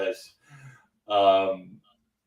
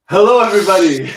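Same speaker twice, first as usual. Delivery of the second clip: high, loud, fast hello; thrilled opening shout; excited greeting; 8 semitones higher, 13 dB louder, faster than usual. Real, not acted. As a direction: burst in with joy.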